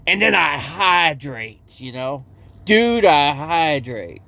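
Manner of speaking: slow, drawn-out laid-back dude voice; slow stoner-dude Cajun cook; Cajun cook impression. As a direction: drawl it out like a chill Cajun cooking guy. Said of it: "that's how he talks".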